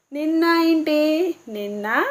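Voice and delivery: ordinary talk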